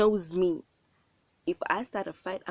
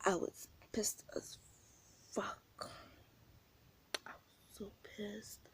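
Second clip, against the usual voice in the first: whispered anger; 12 dB quieter, slower than usual. Real, not acted. Recalling her anger.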